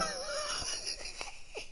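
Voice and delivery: very high, soft, airless wheeze; squeaky silent laugh. Real, not acted. Streamer staring at the character, squeaky wheeze.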